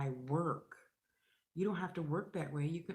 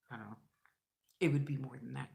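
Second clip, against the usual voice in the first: ordinary delivery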